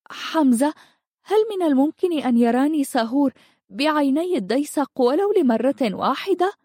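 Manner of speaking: high-pitched, eager plea in a heroine's voice; excited. A narrator's reading. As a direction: ask it bursting with hope, pitch lifted high. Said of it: "وهي تثب من فرط الانفعال". (بانفعال وحماس، بصوت مرتفع الطبقة)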